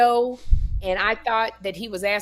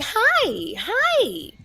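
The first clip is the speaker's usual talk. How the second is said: imitating Sandy; 14 semitones higher than usual. Storyteller mimics the other girl greeting her, high mock voice. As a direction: sing out an airy, high-pitched girl's hi. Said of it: "And she was like".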